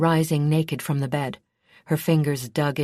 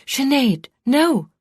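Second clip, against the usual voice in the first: sharp high cry of alarm; a desperate shout to halt her friend; exclaiming; 10 semitones higher, 7 dB louder, slower than usual. A narrator's reading. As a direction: cry out to stop her, higher and louder. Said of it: "Tobin exclaimed".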